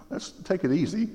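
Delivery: high, soft, stalling voice; reluctant believer. Retold or imitated. Believer stalling God, high and hesitant. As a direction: hold God off nervously. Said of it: "and you're kinda like".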